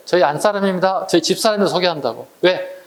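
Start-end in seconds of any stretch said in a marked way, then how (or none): none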